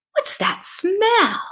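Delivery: high, slow suspicious question; a prim old aunt catching a whiff; great aunt sniffing. Acted. Great Aunt Bleach noticing a smell, high suspicious voice. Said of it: "Great Aunt Bleach said".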